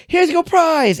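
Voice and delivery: announcer. Retold or imitated. Hosts announce his prize.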